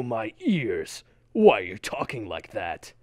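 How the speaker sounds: ordinary delivery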